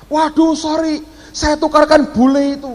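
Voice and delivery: high-pitched acted "oops, sorry"; imitated flustered apology. Passenger admits she swapped the boarding pass, imitated flustered apology.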